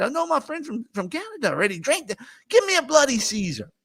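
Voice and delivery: high, excited voice for the order; eager order. Retold or imitated. Telling Steve how he eagerly ordered the drink, high-pitched and excited.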